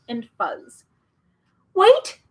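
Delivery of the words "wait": very high, loud call; shout. Acted. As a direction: call after the bird. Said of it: "shouted Milo".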